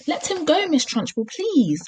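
high-pitched pleading; begging. A narrator's reading. Teacher to headmistress hurting a boy, begging. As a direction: plead with her to let go. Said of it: "begged Miss Honey".

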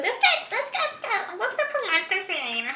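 high, slurred drunk imitation. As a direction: ham up a tipsy friend. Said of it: "She's like".

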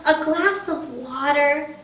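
lightly voiced pleading pigeon, wheedling and bargaining; a cheeky pigeon stalling bedtime. Pigeon begs the child to let him stay up, lightly pleading.

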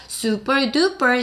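super duper drawn out slowly; teacher modelling slowly; slow model phrase. Shown as a demonstration. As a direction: stretch out super duper evenly. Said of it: "시작".

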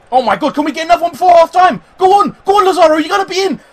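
high, loud, fast urging of the attack; excited shouting. Real, not acted. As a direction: yell the players forward. Late attack before half time.

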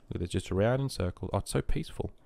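soft, hushed, almost whispered; mock-reverent near whisper. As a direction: drop the voice to a hush so nothing stirs. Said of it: "I was trying to whisper".

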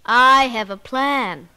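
slow, loud scheming voice; plotting stepmother. Acted.

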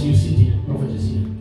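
ordinary talk

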